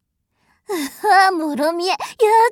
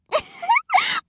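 very high rising giggle; high mocking giggle. Mistress giggling down at her pet, very high. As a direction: giggle at him.

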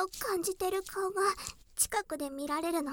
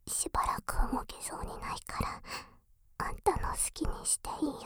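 intimate whisper. Acted. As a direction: whisper close and inviting.

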